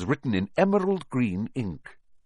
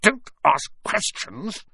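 slow, high, strained order; snapping; 12 semitones higher, slower than usual. A narrator's reading. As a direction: snap at your nephew, word by word. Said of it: "snapped his uncle".

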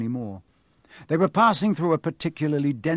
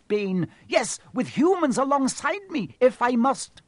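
defiant anger; 10 semitones higher than usual. Acted. Centaur defies rival.

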